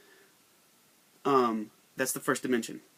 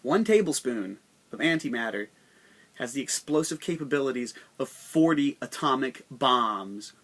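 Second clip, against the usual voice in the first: ordinary delivery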